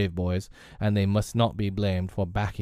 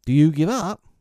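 high, louder challenge; crowing, triumphant question; taunting boy; 8 semitones higher, 7 dB louder than usual. A narrator's reading. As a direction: dare them in a raised boyish voice. Peter taunts the puzzled pirates.

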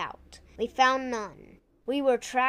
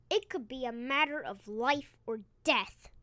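usual speaking voice

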